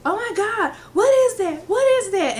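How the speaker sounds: high, loud collective disgust; mock appalled outcry. Imitating classmates reacting to the smell, high outcry. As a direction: cry out as if hit by a stench.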